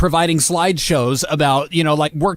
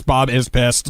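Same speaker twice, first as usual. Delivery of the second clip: flat robotic readout, lower; 5 semitones lower, slower than usual. Pretend. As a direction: speak it like a machine. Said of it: "in a robot voice".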